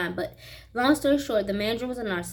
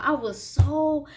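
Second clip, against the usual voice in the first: ordinary talk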